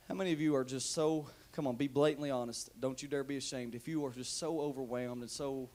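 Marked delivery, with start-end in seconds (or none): none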